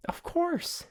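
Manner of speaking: high, put-on character voice, sheepishly explaining; high-pitched, earnest little sidekick; innocent roommate Cheese. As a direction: squeak out a meek excuse. Game master plays Cheese.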